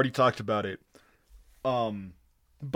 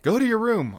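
lightly acted parent, pitched up; mock scolding, higher than usual; parent's order; 8 semitones higher, faster than usual. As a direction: send the kid off in a raised voice. Acting parent sending kid away.

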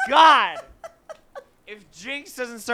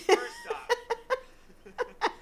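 laughing; 11 semitones higher, 7 dB quieter, much slower than usual. Real, not acted. Laughing away from mic.

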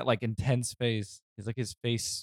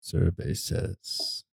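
slow, low game show host drawl; Steve Harvey voice; 7 semitones lower, much slower than usual. Retold or imitated. Friends joking, mimicking the host's slow call. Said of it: "He's like".

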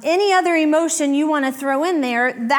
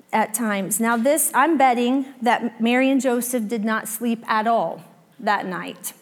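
normal talking voice

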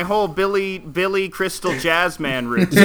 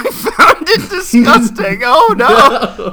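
laughing; 5 semitones higher, 5 dB louder, much slower than usual. Real, not acted. Reading shocking letter aloud.

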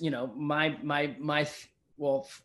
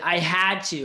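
"had" punched higher and louder; stress on HAD; 3 semitones higher, 7 dB louder than usual. Retold or imitated. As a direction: lean hard on the word "had". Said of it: "He didn't say".

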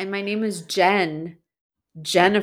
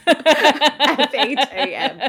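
slow, laughing letters F H A M; amused slow spelling; 5 semitones higher, 4 dB louder, slower than usual. Real, not acted.

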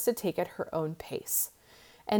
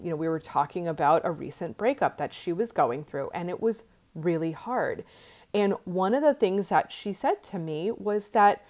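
ordinary talk, nothing marked

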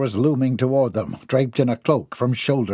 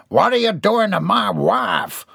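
higher, louder challenge; country husband; 9 semitones higher, 3 dB louder than usual. Acted. Jeb challenges the man on the floor. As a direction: demand an answer like a country man.

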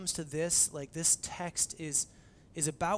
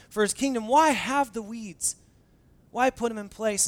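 pleading why; 5 semitones higher, 6 dB louder than usual. Retold or imitated. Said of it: "I would say".

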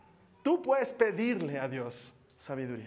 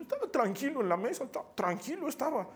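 higher, laughing through excuses; giggly drunk teen; 8 semitones higher than usual. Retold or imitated. Drunk son blaming friends to mom, tipsy laughing voice. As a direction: giggle out a lame alibi. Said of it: "Eso dicen".

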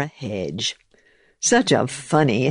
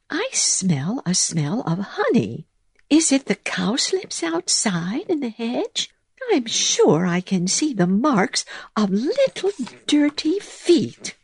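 high mouse voice; 9 semitones higher than usual. Acted. Mouse sniffs out intruder.

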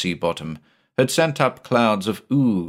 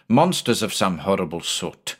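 ordinary delivery, nothing marked

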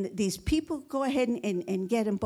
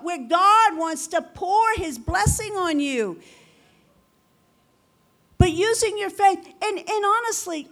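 raised, louder preaching that keeps climbing; full-force preaching; 6 semitones higher, 5 dB louder than usual. Acted. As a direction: preach it out hard to the congregation.

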